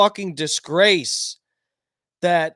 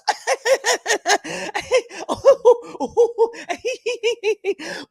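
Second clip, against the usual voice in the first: mock cackle; 13 semitones higher than usual. Mocking Harris's cackle.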